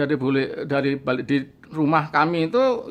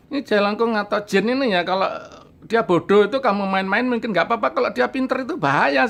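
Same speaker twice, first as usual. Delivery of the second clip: pitched up, insistent warning to a friend; urgent warning; 6 semitones higher than usual. Retold or imitated. Warning his friend that a clever spirit is dangerous, rising insistence. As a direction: press the danger home, voice climbing.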